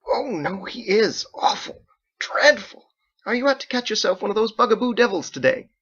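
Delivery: put-on very high, sing-song voice for a little boy; talking to a child. Mother talking down to her grown son as to a child, high sing-song. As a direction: coo at him like a small child. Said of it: "speaking to a child".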